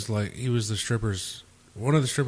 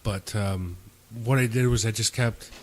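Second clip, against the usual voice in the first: ordinary delivery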